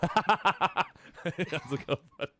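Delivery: high-pitched, breaking up with laughter; laughing greeting. Real, not acted. Streamer greeting Gideon, cracking up. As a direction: greet him through a burst of laughter. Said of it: "Sorry for laughing".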